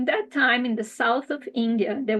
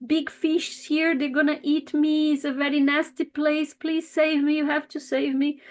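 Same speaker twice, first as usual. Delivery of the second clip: higher fish voice begging to be saved; frightened pleading fish; 4 semitones higher than usual. Acted. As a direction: plead like a scared little fish. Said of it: "and he say".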